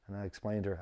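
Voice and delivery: ordinary delivery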